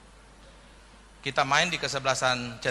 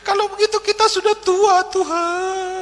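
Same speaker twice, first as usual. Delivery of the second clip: mock crying; 7 dB louder, slower than usual. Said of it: "Orang Cina semua nangis".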